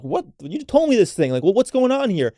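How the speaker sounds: high, loud, indignant disbelief; mock-exasperated outcry; loud expressive outburst. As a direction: act out a partner demanding to know what happened. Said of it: "loud and expressive and like".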